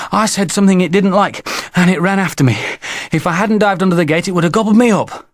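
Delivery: put-on high, excited child's voice; winded, high-pitched young boy; breathless small boy. A narrator's reading. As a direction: blurt out the scare while panting. Breathless boy tells of the chase.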